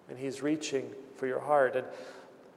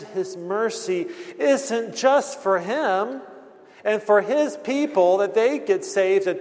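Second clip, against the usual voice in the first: higher, louder, slow impassioned preaching; 6 semitones higher, 4 dB louder than usual. Acted. Pastor urging the congregation toward mercy, impassioned. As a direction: build the plea, slow and fervent.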